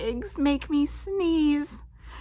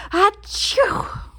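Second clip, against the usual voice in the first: big sudden sneeze; loud sneeze; 8 semitones higher, 11 dB louder than usual. Acted. Character sneezes.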